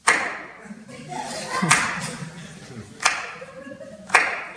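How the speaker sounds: high unvoiced whoop; high whooping cheer. Stepdad breaking the silence with a long high whoop. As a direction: let out a long rising whoop. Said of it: "and he goes".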